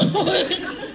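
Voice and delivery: short high laugh. Real, not acted. Teacher laughing after saying I love you to a student. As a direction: laugh at the awkward moment.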